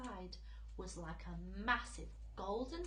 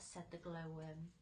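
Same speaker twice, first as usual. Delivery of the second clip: ordinary delivery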